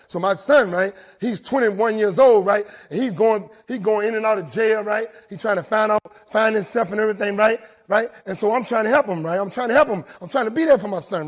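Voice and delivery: loud, fired-up testimony; fired-up telling. Real, not acted. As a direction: belt it out to the room. Building the story about his son.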